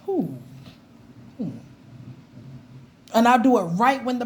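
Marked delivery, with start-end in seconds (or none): 0.1-1.5 disgusted ooh. Retold or imitated. Reacting to someone's smell.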